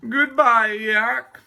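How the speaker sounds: high, loud, drawn-out voice; parting Walrus. A narrator's reading. Walrus leaving talkative Yak, high plaintive farewell. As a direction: call goodbye in a high moan.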